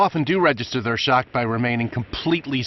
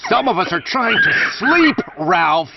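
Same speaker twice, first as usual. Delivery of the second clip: annoyed shout; 6 semitones higher, 5 dB louder than usual. Pretend. Annoyed sleeper yelling at character.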